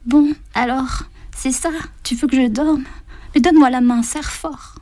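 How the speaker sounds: high, thin voice of a dying woman; frail old woman. A narrator's reading. Dying woman answers Anne. (vieille femme mourante, voix aiguë)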